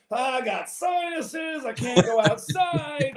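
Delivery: mock sick dad. Son imitates his sick dad complaining about sinuses, slow and whiny. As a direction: play the ailing father complaining he can't go out. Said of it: "he's coughing".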